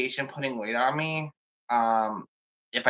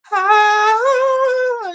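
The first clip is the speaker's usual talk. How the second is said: very high, loud wail of "Ah"; a sudden shrill drawn-out "Ah" of despair; despairing cry; 11 dB louder than usual. Pretend. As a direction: let out one long piercing cry. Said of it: "that's how I feel".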